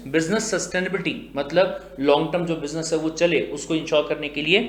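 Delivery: ordinary delivery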